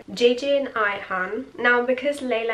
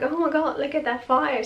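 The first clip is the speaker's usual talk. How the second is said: imitating delighted awe, pitched higher; happy, excited; 6 semitones higher than usual. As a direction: gush with mock-thrilled delight. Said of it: "so happy".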